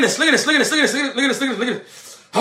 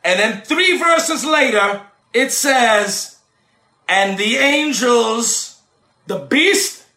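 rising, shouted sermon cadence with long held words; preaching at full force; 7 semitones higher, 4 dB louder than usual. Acted. Preacher preaching judgment of angels to his listeners, shouting. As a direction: preach it to the congregation, building to shouts.

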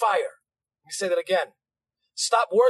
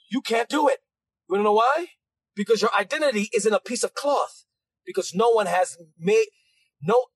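ordinary talk

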